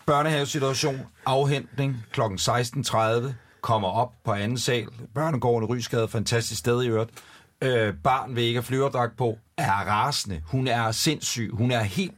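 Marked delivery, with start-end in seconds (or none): none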